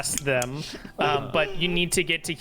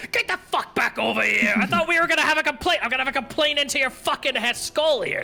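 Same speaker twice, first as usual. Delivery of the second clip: raging chase yell; 9 semitones higher, faster than usual. Acted. Guard chases thief, yelling in fury. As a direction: rant while swinging the mace.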